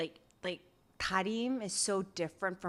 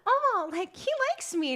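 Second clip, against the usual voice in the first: giddy realization; 13 semitones higher than usual. Retold or imitated.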